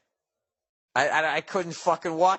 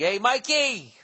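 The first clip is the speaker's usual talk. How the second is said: calling out; 8 semitones higher, 4 dB louder, slower than usual. Retold or imitated.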